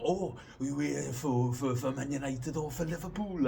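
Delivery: high, loud excited Welsh questioning; Welsh accent impression. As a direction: ask eagerly in a put-on Welsh accent. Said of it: "basis for my Welsh, uh, accent impression".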